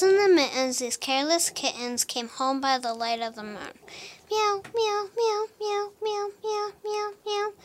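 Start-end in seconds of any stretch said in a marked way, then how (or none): 4.3-7.5 high, sing-song kitten meows; kitten meowing. Acted. Storyteller voicing the kittens' refrain, high playful meows. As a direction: meow like a happy little kitten.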